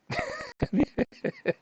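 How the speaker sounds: a short high laugh, real; high amused laugh. Real, not acted. After telling the kid straight up, a high real laugh. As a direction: laugh at your own bluntness.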